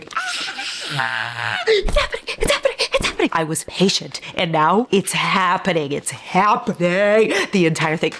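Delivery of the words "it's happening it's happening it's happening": high, slow, drawn-out squeal; excited squeal. Real, not acted. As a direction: let each one climb and stretch. Squealing at a long-awaited scene.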